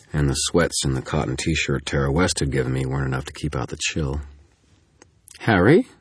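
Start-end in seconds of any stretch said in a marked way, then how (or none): 5.4-5.9 high, louder startled question; incredulous rising question; disbelieving. A narrator's reading. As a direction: ask it in disbelief, pitch leaping up. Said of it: "she said disbelievingly".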